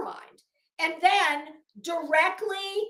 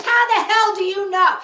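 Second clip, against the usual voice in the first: louder, sharp demand of the absent Betty; indignant outburst; 6 semitones higher, 12 dB louder than usual. Real, not acted. Talking back to Betty's complaint, an indignant louder outburst. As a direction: hurl the question at her, incredulous.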